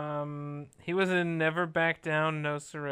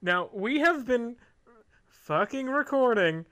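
mock exasperation; 3 semitones higher, 6 dB louder than usual. Co-host grumbling about editing a long recording, mock exasperated. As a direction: drag out the last words loudly, half laughing.

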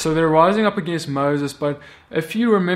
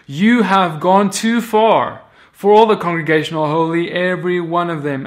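raised, emphatic accusation; accusing challenge; 2 semitones higher, 6 dB louder than usual. Acted. Elders rising up against Moses, accusing. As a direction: confront a leader in front of everyone.

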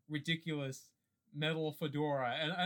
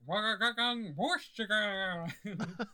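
alien gibberish clicks; 5 semitones higher, 4 dB louder than usual. Acted. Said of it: "It sounds something like".

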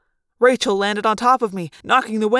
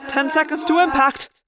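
urgent announcement; 7 semitones higher than usual. Acted. Pilot announces imminent crash.

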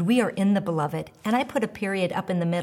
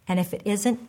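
normal talking voice

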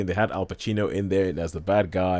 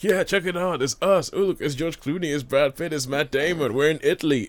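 higher-pitched, gleeful star-struck boasting; excited mock bragging, pitched up; mock showing off; 6 semitones higher than usual. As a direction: gush like actors on a jolly holiday. Voicing film stars showing off.

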